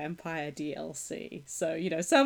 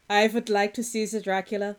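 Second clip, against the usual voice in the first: Arnold Schwarzenegger. Pretend. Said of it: "more Arnold Schwarzenegger".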